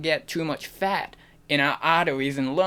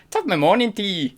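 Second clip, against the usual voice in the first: high, quick Irish greeting; stock Irish accent; 6 semitones higher, 3 dB louder, faster than usual. Shown as a demonstration. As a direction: toss out a caricature Irish lilt. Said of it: "all I can do is".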